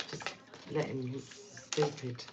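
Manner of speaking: a soft whispered aside, dropped well below the talk; hushed, dismissive whisper. Real, not acted. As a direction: let it slip out under your breath, half to yourself. Muttered aside about ex-husband.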